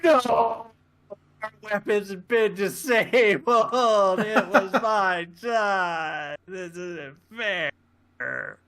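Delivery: high, drawn-out mock wail; hammed-up despair. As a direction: wail slowly like a thwarted child, fading at the end. Shaxs wailing at losing his chance.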